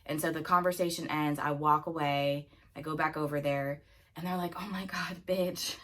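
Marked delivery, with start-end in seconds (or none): 4.6-5.8 imitating friends' hushed gasp; hushed disbelief. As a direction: trail into a stunned whisper. Friends react to awkward confrontation.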